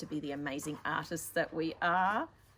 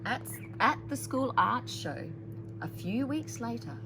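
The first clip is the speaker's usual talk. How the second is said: put-on louder, expressive read-aloud to children; story narration; 7 dB louder, slower than usual.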